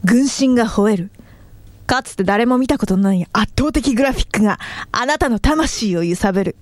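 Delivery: punchy game ad voiceover; commercial read. Shown as a demonstration. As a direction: sell it like a mobile game ad. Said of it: "えっとね、シーエム。".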